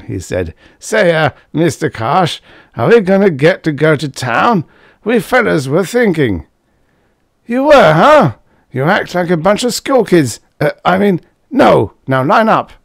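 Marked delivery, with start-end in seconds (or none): 0.8-6.5 higher, louder hopeful lad; eager young student. A narrator's reading. Student voiced in story. 7.4-12.7 much higher, louder scolding sergeant; Karsh scolding. A narrator's reading.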